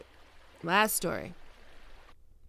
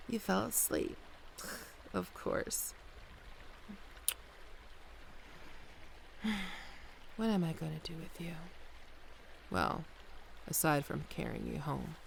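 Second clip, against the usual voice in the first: softer, hushed so as not to wake them; 10 dB quieter than usual. Real, not acted. Listener fell asleep, speaker hushes.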